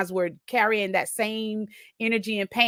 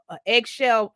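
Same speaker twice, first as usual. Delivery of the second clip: normal talking voice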